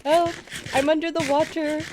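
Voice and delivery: lightly imitated viral-video cry; meme imitation. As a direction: quote it like a silly meme. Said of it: "it makes me think of the".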